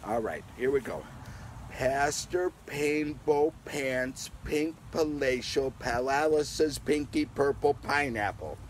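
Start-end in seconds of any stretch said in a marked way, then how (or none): 1.7-8.6 slow, careful tongue twister read; half-speed sing-song reading. Shown as a demonstration. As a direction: go slowly word by word. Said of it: "Let's say them slowly together".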